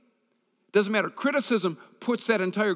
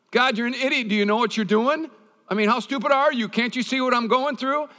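higher and louder, mock exasperation at God; husband voiced scolding God in frustration; exasperated husband scolding; 6 semitones higher, 4 dB louder than usual. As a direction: play the fed-up husband ranting skyward.

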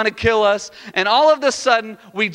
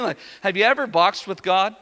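ordinary talk

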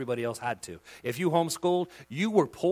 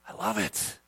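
usual speaking voice

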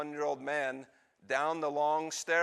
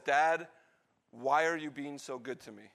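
usual speaking voice

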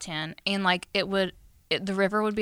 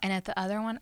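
ordinary talk, nothing marked

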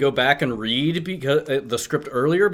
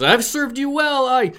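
pleading villain; 11 semitones higher, 3 dB louder than usual. Acted. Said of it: "And Galvatron says".

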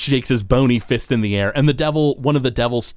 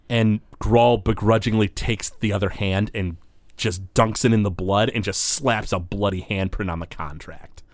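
ordinary delivery

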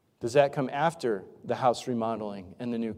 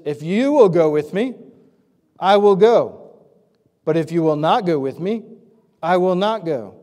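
loud, higher-pitched, firmly declared condition; raised voice, insistent bargaining; emphatic ultimatum; 4 semitones higher, 10 dB louder than usual. Acted. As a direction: lay down your terms forcefully. Acting out Barak's conditional reply to Deborah.

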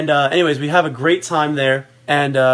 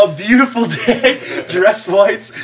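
amused, laughing through the words, raised pitch; higher voice breaking into laughter; 4 semitones higher, 3 dB louder, slower than usual. Real, not acted.

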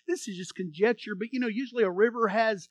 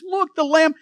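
loud, higher cry of discovery; calling out; 7 semitones higher, 10 dB louder than usual. Retold or imitated. John announcing the Lamb to the crowd, loud. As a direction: point and shout it to the crowd.

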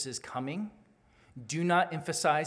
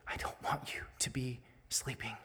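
whispered warning to the church; hushed, urgent whisper; 3 semitones lower, 10 dB quieter than usual. Real, not acted. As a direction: lean in and whisper it. Pastor warns church intimately.

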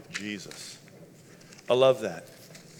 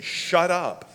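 raised, higher-pitched rebuke; a mildly acted telling-off; scolding crowd; 5 semitones higher, 4 dB louder, slower than usual. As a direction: hush them dismissively. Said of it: "they just kept saying".

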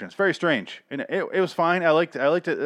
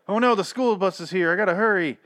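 higher mock-hurried schoolkid; mock panicked kid; 8 semitones higher, 3 dB louder than usual. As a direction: play the kid who realizes he's late. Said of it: "you expect, like".

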